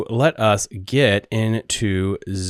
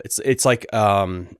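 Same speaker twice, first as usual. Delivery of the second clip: ordinary talk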